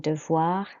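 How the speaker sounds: "devoir" with a W-like "wa" in the second syllable; W-like wa sound. Shown as a demonstration. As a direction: say "devoir" as if it had a W: de-vwa. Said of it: "sounds like it's got a W in there for the wa sound".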